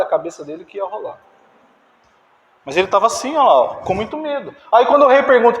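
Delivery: ordinary talk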